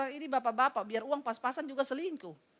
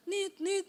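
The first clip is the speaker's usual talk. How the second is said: phone beeps; 8 semitones higher than usual. Retold or imitated. Phone line goes dead.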